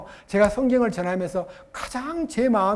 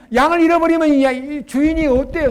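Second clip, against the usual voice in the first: ordinary talk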